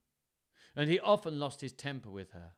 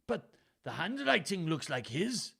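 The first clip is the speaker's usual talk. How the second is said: put-on Welsh accent; 6 semitones higher, 4 dB louder than usual. A narrator's reading. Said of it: "trying to do a Welsh accent".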